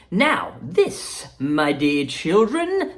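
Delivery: bubbly chocolatier welcoming guests; Willy Wonka voice. Acted.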